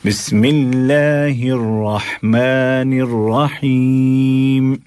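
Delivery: बिस्मिल्लाहिर्रहमानिर्रहीम said in one slow melodic flow, with a doubled ल्ल; correct recitation. Shown as a demonstration. Teacher showing listeners how to begin, slow melodic recitation. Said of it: "बिस्मिल्लाह नहीं पढ़ना है".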